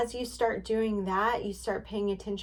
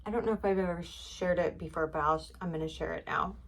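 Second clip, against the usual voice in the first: ordinary talk, nothing marked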